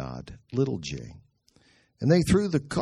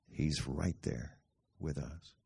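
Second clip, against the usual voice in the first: intimate reassurance; 8 semitones lower, 10 dB quieter, slower than usual. Real, not acted.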